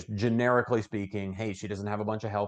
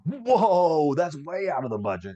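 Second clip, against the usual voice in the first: loud high whoa, then a dismayed complaint; shocked client; 7 semitones higher, 5 dB louder than usual. Retold or imitated. Client reacting to a quote, shocked whoa. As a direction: recoil at the price.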